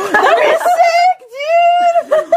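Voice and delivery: high, laughing, drawn-out cheer; excited outburst. Real, not acted. Player thrilled at riding a dire horse, high excited outburst. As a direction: blurt it out high, stretching the words.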